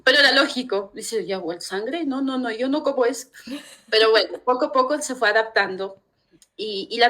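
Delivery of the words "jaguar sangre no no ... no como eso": 1 rechazo imitado. Retold or imitated. Yerno rechaza el jaguar locro.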